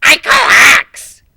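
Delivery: very high, loud, slow squawk; a cartoonish outraged character voice; indignant complaint. Character complains mid-battle.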